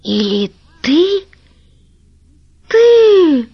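slow, then a high loud shriek of horror; dawning terror breaking into a shriek; horrified. A narrator's reading. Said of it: "вдруг спросила с ужасом". (с ужасом, срываясь на высокий крик)

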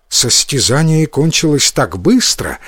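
ordinary delivery

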